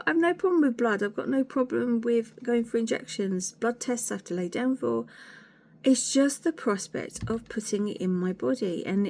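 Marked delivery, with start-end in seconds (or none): none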